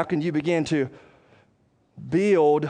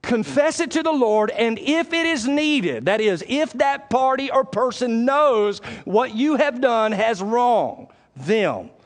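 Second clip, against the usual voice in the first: raised, drawn-out preacher's delivery; forceful preaching; 7 semitones higher, 3 dB louder, slower than usual. Real, not acted. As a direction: proclaim it slowly and high, word by word.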